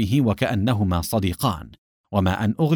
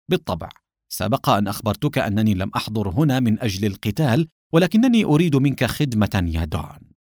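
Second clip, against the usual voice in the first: plain reading voice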